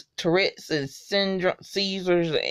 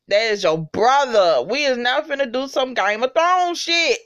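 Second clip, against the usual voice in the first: ordinary talk, nothing marked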